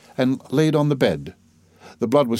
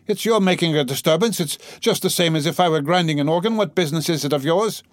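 higher-pitched, argumentative woman; protesting in a raised, insistent pitch; indignant gentlewoman; 7 semitones higher than usual. Acted. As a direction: retort as an affronted lady.

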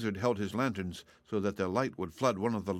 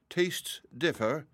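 very slow, higher, drawn-out remark; murmured; 8 semitones higher, much slower than usual. A narrator's reading. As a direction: let it drift off lazily. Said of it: "murmured the dragonette".

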